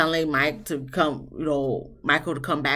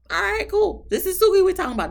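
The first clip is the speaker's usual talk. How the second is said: sarcastic; 14 semitones higher, 4 dB louder, faster than usual. Real, not acted. Sarcastic aside about Suki.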